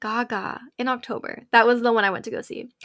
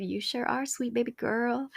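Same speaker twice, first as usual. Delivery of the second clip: soft Lord voice; 7 dB quieter than usual. Retold or imitated. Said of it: "good Lord above said".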